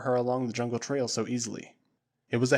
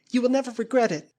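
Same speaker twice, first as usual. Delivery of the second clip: high, louder burst of gratitude; eager grateful girl; 11 semitones higher, 5 dB louder than usual. Acted. Flora vowing loyalty to Tarzan, high and eager. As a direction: promise it brightly and high.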